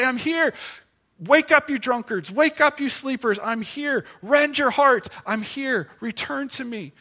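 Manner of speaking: preacher voicing God's insistent wake-up call; urgent calling out. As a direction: call out to rouse the sleepers. Preacher voices God calling people.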